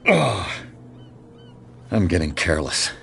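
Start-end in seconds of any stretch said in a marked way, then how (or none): 0.1-0.5 short pained grunt as a blow lands, higher and louder; a sudden grunt knocked out of him. Acted. Thug hits detective.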